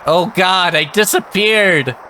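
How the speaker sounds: high alarmed exclamation; startled. Real, not acted. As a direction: cry out in surprise. Glider vanishes onscreen.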